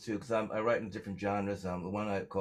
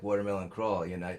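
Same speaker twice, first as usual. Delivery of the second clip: normal talking voice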